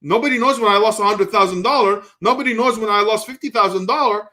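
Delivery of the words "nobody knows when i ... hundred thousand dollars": usual speaking voice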